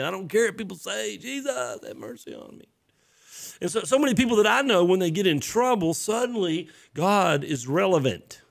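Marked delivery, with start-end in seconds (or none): 0.0-2.7 high, hushed imitation of a yell; mock crying out. Blind man begging Jesus for mercy, mock hushed cry. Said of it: "crying out and yelling and saying".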